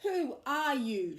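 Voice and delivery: startled and cross; cross shriek. A narrator's reading. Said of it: "shrieked Dora crossly".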